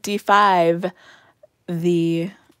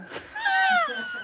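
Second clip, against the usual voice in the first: high, cracking burst of laughter; shrieking laugh; 5 dB quieter than usual. Real, not acted. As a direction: squeal with laughter at your own joke. Laughs at her own B O joke.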